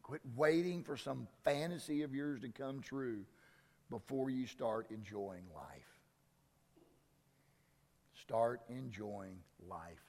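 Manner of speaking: low, hushed pastoral appeal, slowing at times; gentle preacher winding down to a near whisper; soft sermon close. Acted. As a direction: draw the congregation in quietly. Soft closing exhortation of sermon.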